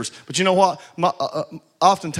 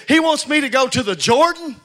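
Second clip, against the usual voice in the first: indignant Naaman; 7 semitones higher, 3 dB louder than usual. Retold or imitated. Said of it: "And he's saying".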